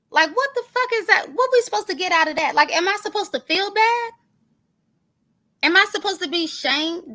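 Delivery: outraged disbelief. Real, not acted. Demanding answers from the man who played her tape, outraged. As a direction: fire the questions off in outrage.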